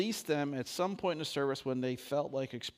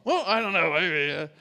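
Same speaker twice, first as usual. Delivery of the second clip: excited lead-in; 5 semitones higher, 12 dB louder than usual. Real, not acted. Animated lead-in to singing.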